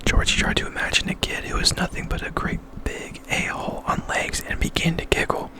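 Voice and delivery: plain reading voice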